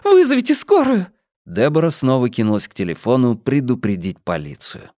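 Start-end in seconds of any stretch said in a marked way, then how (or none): 0.0-1.1 put-on high, urgent girl's plea; high-pitched distressed cry; desperate wail. A narrator's reading. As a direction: beg frantically in a very high voice. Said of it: "простонала Нола".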